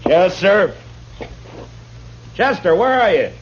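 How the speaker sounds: impatient shout into the back room; calling out. Acted. Marshal calls for his absent helper.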